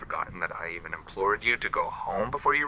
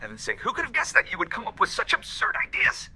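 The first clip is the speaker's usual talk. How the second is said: scoffing; 12 semitones higher than usual. Acted. Alf scoffs at her accusation.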